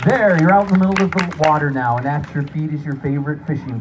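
energetic showman narration; guided hallucination. Acted. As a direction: paint the scene for them.

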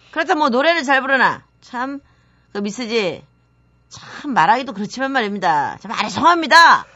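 exasperated boss picking faults; nagging boss. Acted. Boss criticising Miss Ji's handwriting, nagging. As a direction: scold the clerk with theatrical exasperation.

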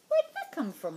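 loud, high surprised question; surprised boy asking his best friend. Acted. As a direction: caught off guard, voice jumps up. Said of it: "I asked, surprised".

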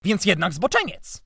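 high, loud outraged exclamation; exclaiming. A narrator's reading. Uncle pouncing on the boy's words, exclaiming. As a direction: cry it out as an accusation. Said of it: "wykrzyknął".

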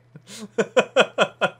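loud burst of laughter; high loud laugh. Real, not acted. Laughing after dropping item.

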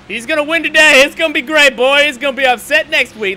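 loud, high bitter sneer; sarcastic angry rant. Real, not acted. Racer ranting about Dale Junior winning, sarcastic yell. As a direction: spit it out mockingly.

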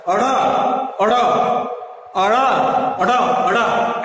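flick the tongue back to front on अड़ा; drill of ड़. Shown as a demonstration. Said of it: "ऐसे करके आप देख सकते हैं". (ड़ का अभ्यास, धीरे धीरे)

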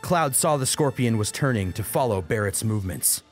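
plain reading voice

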